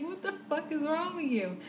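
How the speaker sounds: high-pitched mock outrage; incredulous retold reproach; imitated exasperated boyfriend. Acts out boyfriend after her fall.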